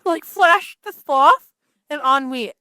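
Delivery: slow, dragged-out sloth delivery. Acted. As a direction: stretch it out like a sloth. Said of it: "I'm like the Flash and ennui".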